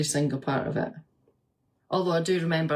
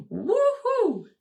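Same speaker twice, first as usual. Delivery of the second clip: very high falsetto whoop; high pain whoop; 3 dB louder than usual. Retold or imitated. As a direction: yelp it up like the pain peaking. Voicing labour pain.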